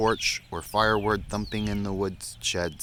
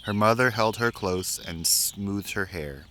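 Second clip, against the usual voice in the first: plain reading voice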